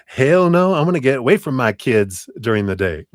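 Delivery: loud, high "hell no" settling down; mock emphatic refusal. Reader imagines the client rejecting work with children, in mock protest. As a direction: voice an imagined client's playful outburst.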